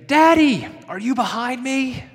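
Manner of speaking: put-on high, loud cry of a small boy calling out; little boy screaming. Boy on his bike screaming for his father behind him, high and loud. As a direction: call out like a small child who can't see his dad. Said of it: "he starts screaming".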